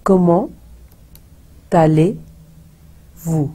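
comment with silent t, allez-vous drawn out slowly; careful French teaching demo; French pronunciation. Shown as a demonstration. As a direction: say comment as "komo", then a long, slow allez-vous. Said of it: "जो टी है वो silent है".

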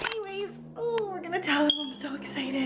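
high-pitched giddy squeal; squealing excitement. Real, not acted. Mom squealing to the camera about telling the girls. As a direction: bubble over with glee.

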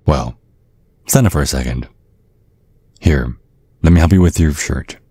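ordinary talk, nothing marked

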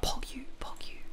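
hushed, whispered little aside; whispering. Real, not acted. Streamer hoping to catch a later stream, whispering. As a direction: whisper it very softly.

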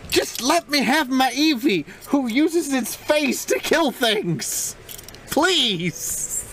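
high, slow whiny begging; whiny pleading. Pretend. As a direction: beg for it in a high whine. Whining for his Eevee.

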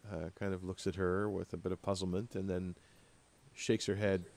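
ordinary talk

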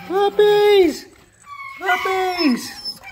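high-pitched excited squeal; excited calling out. Real, not acted. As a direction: call out "puppies" in a high, drawn-out cry.

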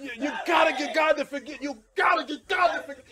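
rising, shouted exhortation; preaching at full force. Acted. Preacher pressing the congregation to seek forgiveness, shouting. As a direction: drive the warning home loud.

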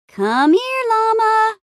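rising, sing-song high call; coaxing animal call. Acted. Adventurer trying to catch a llama, coaxing call. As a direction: draw it out, climbing high.

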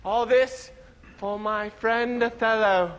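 low, slow, solemn stage delivery; hammy Shakespeare actor. Acted. Stage Hamlet mourning his friend, low and slow. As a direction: declaim it like a grave tragedian.

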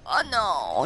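usual speaking voice

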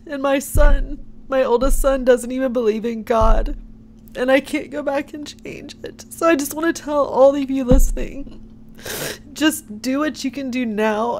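holding back tears while speaking; teary-eyed. Real, not acted. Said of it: "I'm sorry I get teary-eyed".

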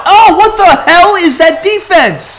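high, loud exasperated yell at the defense; furious shout. Real, not acted. Gamer yelling at his useless AI defenders, furious high shout. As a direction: shout it like you can't believe how bad they are.

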